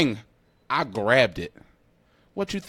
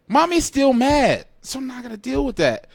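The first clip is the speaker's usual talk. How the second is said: exasperated; 12 semitones higher, 6 dB louder than usual. Real, not acted. Kid dreading mom still angry after school, high exasperated. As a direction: let the disbelief spike.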